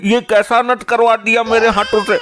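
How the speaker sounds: high, stammering dismay; flustered panic. Acted. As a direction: fluster in alarm at your own blunder. Panics after hitting his wife. (घबराहट में ऊँची आवाज़)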